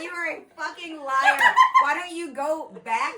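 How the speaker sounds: high, loud laughing yell; shrill overlapping reality fight. Acted. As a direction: scream it like a reality show fight. Yelling at the mom in the clip.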